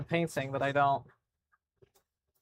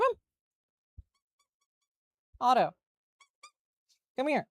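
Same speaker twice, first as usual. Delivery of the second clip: higher, sing-song calls to the dog; very high coaxing calls to the dog to come find the toy; high calling voice; 12 semitones higher, 4 dB quieter than usual. Real, not acted. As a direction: call the dog over, high and soft.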